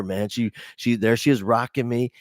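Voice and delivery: normal talking voice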